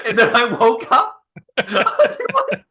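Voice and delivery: breaking into laughter mid-sentence, voice jumping high; real laughter spilling through the words; laughing. Real, not acted. Host laughing at his own dream story.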